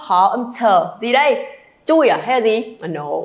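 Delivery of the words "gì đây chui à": ordinary delivery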